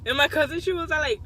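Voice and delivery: ordinary talk, nothing marked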